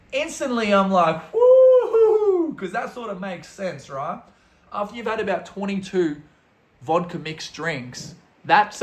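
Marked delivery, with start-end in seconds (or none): 1.3-2.6 loud, high, drawn-out woo-hoo; whoop of relief. Retold or imitated. Drunk tourist hears it was only police, relieved whoop. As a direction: let out a big laughing whoop. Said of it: "instant just relief".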